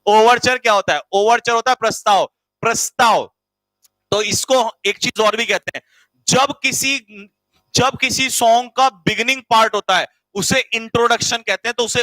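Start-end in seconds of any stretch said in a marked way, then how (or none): none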